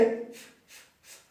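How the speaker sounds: "f, f" blown out with no voice; voiceless f sound. Shown as a demonstration. Teacher showing the voiceless f sound to students. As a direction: hiss a voiceless f. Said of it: "una F".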